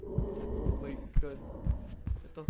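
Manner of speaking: slow breathless whisper; whispering while hiding. Real, not acted. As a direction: whisper it, scared of being heard. Hiding from monster.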